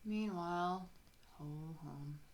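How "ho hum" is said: bored. Acted. Narrator bored in Sleepy Gulch.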